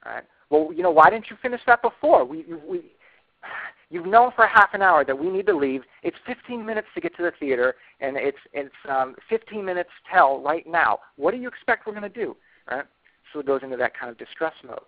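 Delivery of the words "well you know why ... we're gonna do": acting out an upset partner, louder and higher; exasperated, raised voice at the lagging partner. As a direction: scold them for running late. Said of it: "partner A starts to get really upset".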